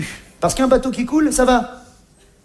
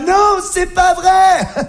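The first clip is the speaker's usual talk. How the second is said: amazed Véronique; 11 semitones higher, 4 dB louder, much slower than usual. Retold or imitated. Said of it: "Je la revois".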